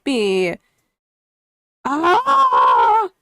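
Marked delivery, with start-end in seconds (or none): 1.8-3.1 short, higher exasperated shout; frustrated yell. Real, not acted. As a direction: let out a cry of annoyance. Frustrated over missing notification.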